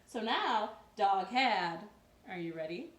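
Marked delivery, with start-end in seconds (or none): none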